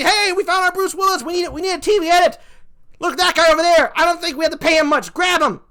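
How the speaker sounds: high-pitched, hammed-up excited TV editor. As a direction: pounce on a cheap casting find, high and mock-eager. Acting out editors recruiting voice actor.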